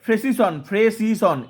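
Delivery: ফ্রেসিসন said slowly, opening on a joined "fre"; teacher's slow model of ফ্রেসিসন with "fr" run together; careful pronunciation. Shown as a demonstration. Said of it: "উচ্চারণটা তোমাদের একই মনে হবে".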